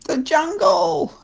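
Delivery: high, slow whiny creature; crying monkey voice. Acted. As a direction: wail it like a little monkey. Monkey answers.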